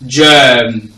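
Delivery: GERM loud and drawn out; stressed pronunciation. Shown as a demonstration. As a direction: put full emphasis on germ, holding the vowel. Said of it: "stress and place emphasis".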